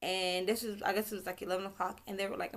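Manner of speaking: ordinary talk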